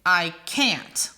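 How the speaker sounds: "I can't" with weak "I", stressed "can't"; iambic stress. Shown as a demonstration. Teacher giving a two-word iamb, stress on "can't". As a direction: land the weight on "can't" in "I can't". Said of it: "Unstressed, stressed".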